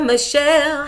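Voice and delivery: normal talking voice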